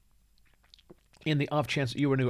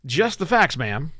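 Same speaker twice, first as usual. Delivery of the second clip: louder, higher podcast read; own announcer voice; 4 semitones higher, 5 dB louder, slower than usual. Shown as a demonstration. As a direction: punch it out as your show's opener. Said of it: "I'm just gonna say it this way".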